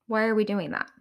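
ordinary talk

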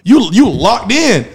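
hyped retelling. Retold or imitated. Friend telling the crew how hooked he got, hyped. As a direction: sell how hooked you were.

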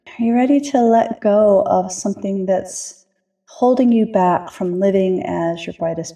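ordinary talk

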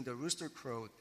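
normal talking voice

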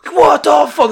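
high, loud group outburst; retold shocked yell; erupting in shock. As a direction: explode in disbelief. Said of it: "The van erupted with".

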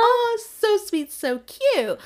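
high, slow gushing over a gift; mock gushing. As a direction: gush like you got a present.

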